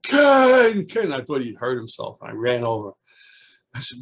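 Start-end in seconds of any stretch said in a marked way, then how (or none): 0.0-1.1 high, loud urgent call for the teacher; high excited child's yell; child screaming. Retold or imitated. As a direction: shout his name like something's wrong. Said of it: "Eddie all of a sudden screams".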